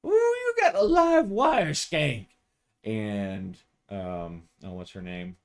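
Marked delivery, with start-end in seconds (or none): 0.1-2.3 high, mocking teasing voice of a cop colleague; a cheeky female cop ribbing her partner; teasing coworker. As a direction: needle him sweetly, high and sly. [name] teasing.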